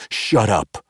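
frustrated growl. A narrator's reading. Zaknafein silences Jarlaxle with a frustrated growl. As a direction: bite it off in frustration. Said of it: "Zaknafein growled in frustration".

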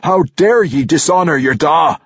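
high, loud dwarf-king roar; outraged scream. A narrator's reading. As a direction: shout it in indignant fury at a son. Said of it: "King Emerus had screamed in his face".

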